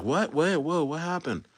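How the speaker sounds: hushed mock panic, slowing at the end; mock confusion. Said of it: "they're like".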